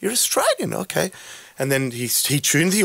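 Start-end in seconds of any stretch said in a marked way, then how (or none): 0.0-1.1 high mock surprise, lightly voiced; a touch of surprised Frenchman; concertmaster realising. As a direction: pitch it up as the concertmaster gets it. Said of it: "He said".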